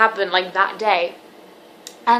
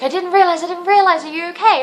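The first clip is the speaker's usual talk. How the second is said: much higher and louder, imitating her surprised friend; friend's concerned reply; 9 semitones higher, 5 dB louder than usual. Best friend hearing about the breakup, high shocked concern. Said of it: "she was like".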